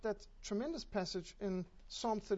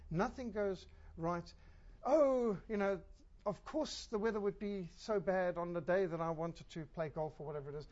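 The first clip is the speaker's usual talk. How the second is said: ordinary delivery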